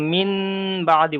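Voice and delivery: "মিন" ending in a held n before "বাদি"; wrong reading demonstrated. Shown as a demonstration. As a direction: keep an n sound in "মিন", unchanged before "বাদি". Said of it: "বলছি না".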